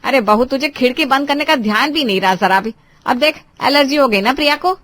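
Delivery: cross mother-in-law, loud and reproachful; blaming scold. Acted. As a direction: scold her for carelessness. Mother-in-law blames Richa for cough.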